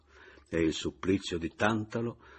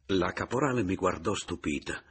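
plain reading voice

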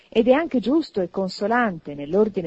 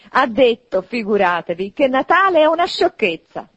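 loud, higher-pitched exclamation; crying out; 5 semitones higher, 8 dB louder than usual. A narrator's reading. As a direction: call it out to the whole company. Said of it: "gridava il nipote di Scrooge".

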